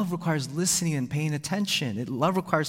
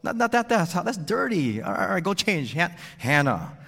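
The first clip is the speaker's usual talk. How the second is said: loud, higher, impatient stream of orders; hurried berating dad; 4 semitones higher, 3 dB louder than usual. Retold or imitated. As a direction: rush the kid to bed, barking orders. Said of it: "I berated her with directions and critiques".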